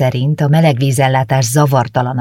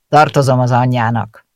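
grumpy baker, louder; 4 dB louder than usual. A narrator's reading. Baker grumpily insists he owes her mother. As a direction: declare it sullenly. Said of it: "jelentette ki mogorván".

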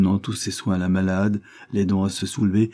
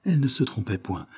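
usual speaking voice

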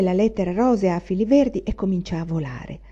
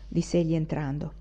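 ordinary delivery, nothing marked

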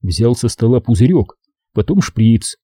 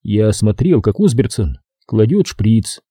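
ordinary delivery, nothing marked

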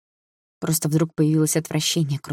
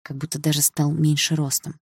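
usual speaking voice

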